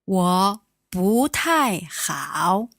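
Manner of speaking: slow, careful Mandarin model of 我不太好; teacher says 我不太好 slowly, each tone clear; slow model phrase. Shown as a demonstration. Teacher modelling for learner, slow careful Mandarin.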